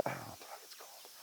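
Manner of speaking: whispered fast under the breath; a quick whispered aside to himself; hushed fast mutter. Real, not acted.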